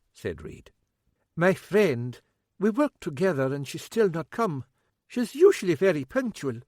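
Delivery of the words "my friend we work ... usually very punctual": put-on lightly higher, fretful older Scottish woman; fussing, a wee bit worried cook. A narrator's reading. Cook to constable about a missing friend, fretful and higher.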